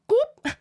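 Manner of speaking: high, loud imitated bird "goop"; weird raven sound. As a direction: pop out a strange bird noise. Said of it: "made a weird sound".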